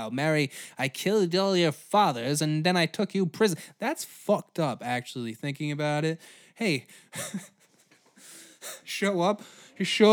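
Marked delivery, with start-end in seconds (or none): none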